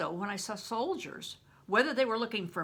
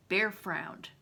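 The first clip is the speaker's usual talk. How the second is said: ordinary delivery, nothing marked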